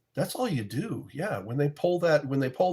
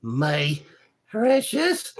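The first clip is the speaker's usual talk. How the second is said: Gollum impression; 3 semitones higher, much slower than usual. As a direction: whisper it then squeal it like Gollum. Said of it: "Frodo of the Nine Fingers".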